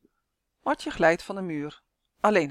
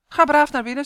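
ordinary delivery, nothing marked

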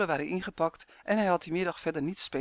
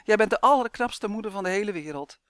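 loud, emphatic flattery; hurried shout; 3 semitones higher, 7 dB louder than usual. A narrator's reading. Boy placating his tickling mother, shouting. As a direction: call out the compliment to escape. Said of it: "schreeuwde Roy vlug".

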